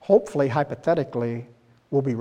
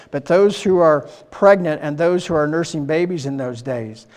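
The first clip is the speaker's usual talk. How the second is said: louder, declaiming scripture reading; 3 semitones higher, 6 dB louder than usual. Acted. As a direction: proclaim the passage to the congregation. Reads Matthew 24 aloud.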